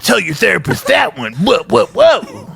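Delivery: hammed-up tipsy mother shouting; drunk mom voice. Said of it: "She'd be like".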